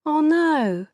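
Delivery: high, breaking "oh no" as tears come; high tearful wail; starting to cry. A narrator's reading. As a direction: let the voice crack into tears. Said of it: "started to cry".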